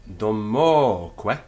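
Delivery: "domoque" with the middle o stressed, loud and drawn out; accent on O. Shown as a demonstration. Teacher showing where the accent falls, "domoque" stressed on mo. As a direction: lean long on the second syllable of "domoque". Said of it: "the accent there falls on the".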